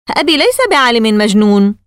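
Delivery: mildly indignant, defending his father; angry boy. A narrator's reading. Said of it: "قال كيسي بغضب". (غضب طفولي خفيف)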